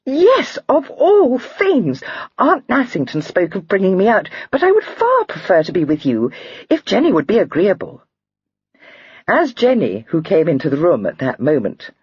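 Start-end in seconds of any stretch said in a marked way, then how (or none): 0.1-8.0 overjoyed at the invitation; rapturous. A narrator's reading. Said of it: "Lydia cried rapturously".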